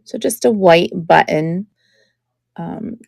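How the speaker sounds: ordinary talk, nothing marked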